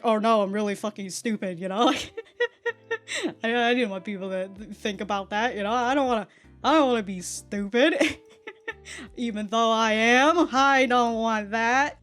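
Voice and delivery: usual speaking voice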